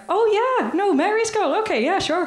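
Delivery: high-pitched imitated Northern Irish caller; Northern Irish accent. Caller finally recognising who Helen is, high Northern Irish accent. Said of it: "with the accent".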